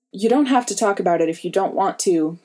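ordinary delivery, nothing marked

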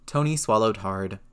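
ordinary delivery

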